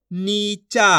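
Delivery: नीचा in two long syllables. Shown as a demonstration. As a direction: stretch नी, then चा, in नीचा. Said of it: "एक एक अक्षर का उच्चारण". (नीचा के दोनों अक्षर खींचकर)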